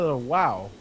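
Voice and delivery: ordinary delivery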